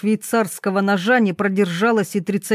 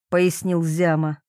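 ordinary delivery

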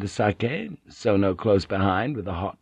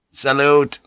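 a short, sharp, louder salute; crisp command; 5 semitones higher, 5 dB louder than usual. Acted. Guard greets the stern disciplinarian with a crisp salute. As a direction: snap the order out briskly. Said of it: "he said crisply".

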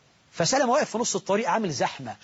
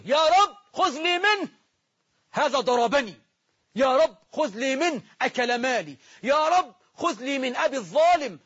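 a crowd of the wronged calling out for their rights; wronged pleading to God; 7 semitones higher than usual. Acted. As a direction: cry out to God as the wronged victim. The wronged pleading on Judgment Day.

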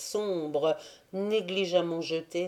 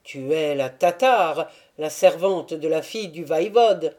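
louder, excited exclamation; sudden recognition; 5 dB louder than usual. A narrator's reading. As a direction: blurt it out as it dawns on him. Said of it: "s'écria tout à coup".

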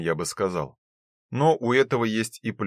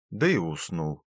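plain reading voice

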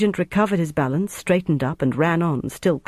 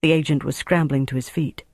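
ordinary delivery, nothing marked

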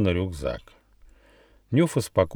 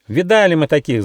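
high, loud cocky squirrel; spirited, raised-voice bravado; feisty shout; 8 semitones higher, 6 dB louder than usual. A narrator's reading. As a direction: yell it defiantly and perkily. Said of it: "задорно закричал Рыж".

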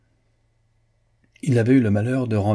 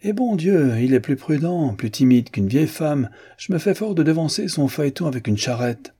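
mocking exclamation; 7 semitones higher than usual. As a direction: cry out in scorn at a timid rival. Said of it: "s'écria monsieur Coverley".

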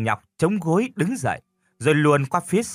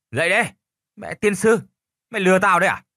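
put-on loud, higher drunken yelling; drunken shout; 6 semitones higher, 4 dB louder, faster than usual. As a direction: bellow to wake someone up. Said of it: "Tiếng gọi của Dương quá lớn".

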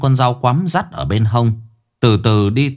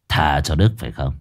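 cold low boss; 7 semitones lower, slower than usual. Acted. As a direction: weigh each word like a verdict. Boss pronounces the cold verdict.

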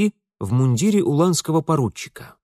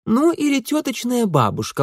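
higher-pitched, lightly condescending sister; 11 semitones higher than usual. A narrator's reading. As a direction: concede it with mild condescension. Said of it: "снисходительно добавила".

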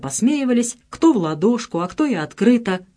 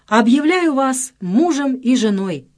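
solemn pronouncement; 5 semitones higher, 3 dB louder, slower than usual. A narrator's reading. Registrar's final pronouncement.

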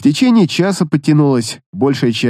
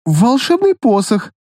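put-on shy girl's voice; 8 semitones higher than usual. A narrator's reading. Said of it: "краснея вбросила ромашка".

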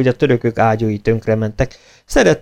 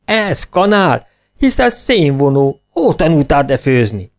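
high, slow, angry yell; scolding yell; 6 semitones higher, 3 dB louder, slower than usual. A narrator's reading. Head cook yells at the new kitchen hand. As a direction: tear into the assistant. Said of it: "ordított a főszakács".

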